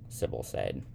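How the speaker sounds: ordinary delivery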